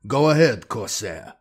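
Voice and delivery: ordinary delivery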